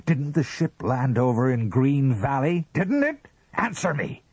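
high, loud, insistent demand; fierce interrogation. A narrator's reading. Husband grips wife's wrist, fiercely demanding an answer. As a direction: climb in pitch to a furious order.

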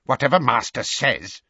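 grudging agreement with fury underneath; furious mutter. Acted. Elf bowing to his master, muttering furiously. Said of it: "he muttered furiously".

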